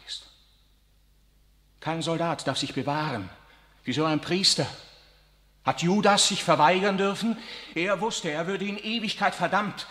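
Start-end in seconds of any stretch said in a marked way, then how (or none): 1.8-9.9 passionate plea. Acted. Riccardo argues passionately.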